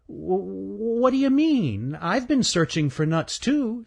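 high, stammering and sheepish. Acted. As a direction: caught out, stumbling over words. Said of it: "said Stanley sheepishly".

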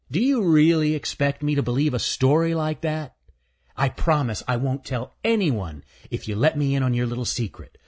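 a smug little chipmunk; teasing chipmunk. Acted. Earl's voice.

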